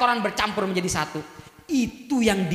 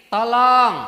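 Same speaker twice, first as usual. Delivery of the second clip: a modest call for help, barely raised; ordinary cry for help; 5 dB louder than usual. Shown as a demonstration. Preacher showing the women an ordinary cry, mild. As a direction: call "Tolong" like a normal person asking for help.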